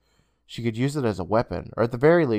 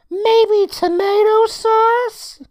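put-on high boyish voice, very slow and unsure; hopeful guess; 8 dB louder, much slower than usual. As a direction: offer a silly hopeful guess. Boy offers hopeful guess.

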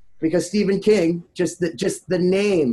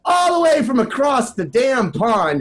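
higher, drawn-out hype call; hype announcer intro; 7 semitones higher, slower than usual. Acted. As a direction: sell the guest like a ring announcer. Hyped announcer introducing guest.

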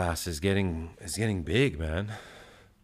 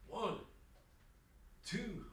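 straining through reps; 13 semitones higher, 15 dB quieter than usual. Real, not acted. Counting reps mid push-ups.

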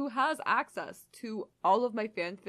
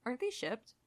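usual speaking voice

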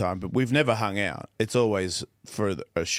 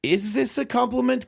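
confused question; 12 semitones higher than usual. Retold or imitated. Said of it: "I was like".

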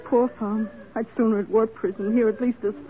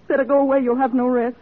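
higher, louder urgent plea; agitated warning; 6 semitones higher, 5 dB louder than usual. Acted. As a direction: push her away in distress. Minnie warns her off.

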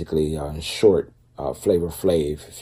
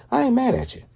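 playful high quip; faster than usual. Retold or imitated. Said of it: "I was like".

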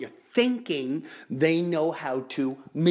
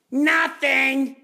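louder emphatic answer; 11 semitones higher, 6 dB louder than usual. Real, not acted. Repeated answer.

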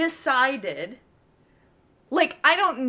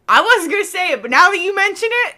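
louder, higher mock agreement; playfully loud "now that you mention it"; 9 semitones higher, 8 dB louder, faster than usual. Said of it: "we'll be like".

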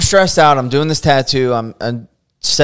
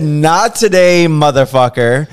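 louder, slower mocking yell; retold yell; 4 semitones higher, 6 dB louder, slower than usual. Artist retelling how he yelled at the client, mock yell. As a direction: jeer it out slowly in triumph. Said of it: "I just screamed this man's first and last name".

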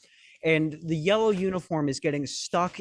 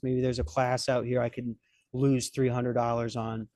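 normal talking voice

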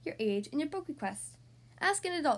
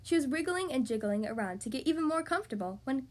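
usual speaking voice

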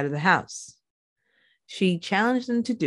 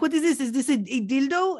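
high, squeaky mock outrage at the find; mock shocked mother; 7 semitones higher than usual. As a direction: play the prying mom holding it up in disbelief. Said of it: "being like".

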